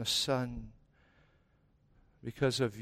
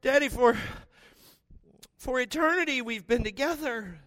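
high, straining plea to the Father; Jesus continuing his anguished prayer; pleading Jesus; 12 semitones higher, 6 dB louder than usual. Acted. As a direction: beg with raised voice. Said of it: "and Jesus says".

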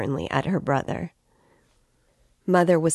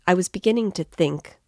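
plain reading voice

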